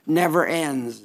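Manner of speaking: ordinary delivery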